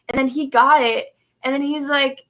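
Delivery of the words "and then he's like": ordinary talk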